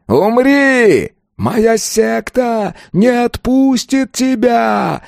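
very high, drawn-out cursing scream; receding cry. A narrator's reading. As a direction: scream threats while falling away. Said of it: "раздался отдаляющийся голос из глубин". (протяжный крик проклятия)

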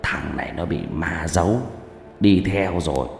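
low, hushed soothsayer; quiet, ominous seer's voice; fortune-teller. A narrator's reading. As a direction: pronounce a grave verdict, soft and low. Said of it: "Thầy bảo".

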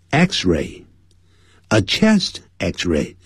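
"a chest X-ray" spelled out very slowly; very slow model. Shown as a demonstration. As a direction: model the phrase word by word for learners. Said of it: "slow model phrase".

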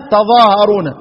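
ordinary delivery

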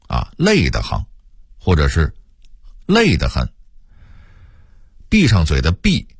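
ordinary talk, nothing marked

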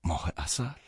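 hoarse, whispered question; stunned hoarse whisper. Acted. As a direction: rasp out the question in a choked whisper. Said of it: "با صدای گرفته پرسیدم".

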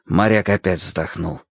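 usual speaking voice

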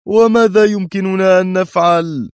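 loud, high cry of helplessness; exasperated, desperate wail of a question; despairing. A narrator's reading. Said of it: "قلب الرئيس كفيه في يأس".

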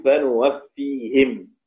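slow فنوفيهم with nun and -him; the rest's reading. Shown as a demonstration. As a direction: recite فنوفيهم ending in kasra. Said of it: "الباقون".

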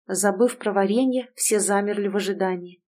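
ordinary delivery

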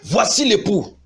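high, ringing call of announcement; crying out. Acted. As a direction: cry it out as the herald in the verse. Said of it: "On cria". (cri lancé d'une voix haute)

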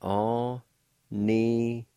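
Aninadeki drawn out slowly; slow model word. Shown as a demonstration. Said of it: "it's pronounced like this".

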